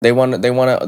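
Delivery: ordinary talk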